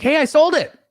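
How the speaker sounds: high, bright boast; excited news. Retold or imitated. Josiah calling back thrilled, high and eager. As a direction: announce the sale with glee.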